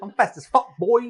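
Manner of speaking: boasting. Real, not acted. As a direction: brag about being fast.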